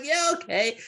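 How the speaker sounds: lightly acted high, loud agreement; mock-eager movie quote; eager lad. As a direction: go high and punchy as the keen lad. Repeats the lad's eager agreement.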